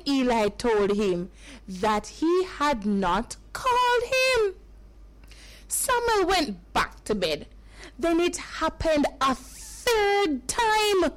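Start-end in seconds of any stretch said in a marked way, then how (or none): none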